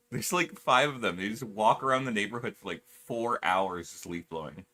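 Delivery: ordinary talk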